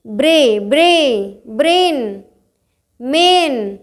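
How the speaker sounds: "ब्रे, ब्रे, ब्रेन, मेन" with drawn-out ay; lengthened ay. Shown as a demonstration. Teacher building up brain from bray, "ब्रे, ब्रे, ब्रेन, मेन" stretched. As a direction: build "ब्रे" into "ब्रेन", then "मेन", holding each vowel. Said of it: "कुछ लंबा सा स्व".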